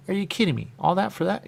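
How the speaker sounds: loud and high, mock disbelief at the price; scandalised by what it cost; outraged. Reacting to the receipts, loud mock outrage.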